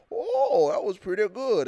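high, hammed-up old man voice. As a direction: mimic a gravelly old-timer approval.

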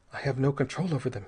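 usual speaking voice

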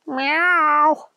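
high mewing kitten voice; cat meow. Acted. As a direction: mew it like a small cat. Cat answers agent.

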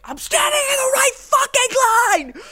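screaming at the top of lungs; into a pillow. Retold or imitated. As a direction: scream it full force in rage. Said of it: "I was like".

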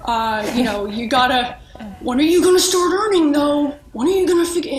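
badgering self-doubt, rising in pitch and volume; old programming voice. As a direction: press yourself like an impatient inner critic. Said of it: "this is my old programming voice".

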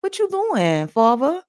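casual New Yorker greeting God; New York accent. Acted. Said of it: "That's my New York accent".

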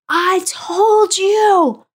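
high, loud, slow triumphant yell; screamed. Retold or imitated. Wife throwing the test at husband, screaming slowly and high. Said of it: "and screamed at him".

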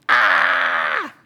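sudden loud alarmed "Ah!"; panicked yell. Retold or imitated. Pastor acting out his panic before the email, loud yell. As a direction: blurt out a burst of panic.

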